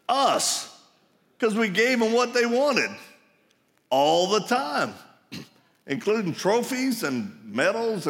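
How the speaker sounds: loud, high preaching push; fired-up sermon voice; full-force preaching. Real, not acted. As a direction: drive it home to the congregation.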